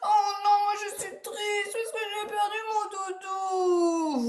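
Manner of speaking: high, drawn-out mock wail; hammed-up weeping, slow and whiny; pretend crying. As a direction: wail like a child playing at sadness. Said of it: "On fait tous comme Camille".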